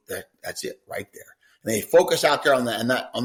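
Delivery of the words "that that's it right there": breathy whisper, no voice at all; whispered coaxing. Shown as a demonstration. Trainer whispering to his dog at a mark. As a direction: coax the dog in a hushed whisper. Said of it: "you really talk 'em into".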